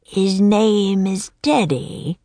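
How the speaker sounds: slow, drawn-out villain voice; gloating Raven King. A narrator's reading. Raven King learns his captive's name, slow gloating. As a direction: savour the name slowly, mockingly.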